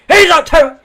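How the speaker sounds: loud, high excited yell; imitated shout. Friend bursting in from the lounge room, loud high yell. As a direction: yell it like a drunk mate charging in. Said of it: "You can hear him running".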